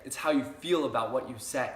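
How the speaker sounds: usual speaking voice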